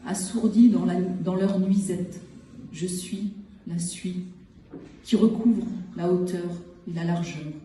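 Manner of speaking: measured poem recital with long pauses between phrases; poetry reading. Acted. Poet reads short poems from the collection to listeners, measured recital. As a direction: set each short phrase apart, letting silence follow.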